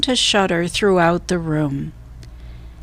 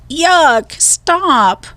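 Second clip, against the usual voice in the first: put-on high, louder girl's cry of disgust; 8 semitones higher, 4 dB louder than usual. A narrator's reading. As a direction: squeal it out, grossed out. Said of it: "cried Annie".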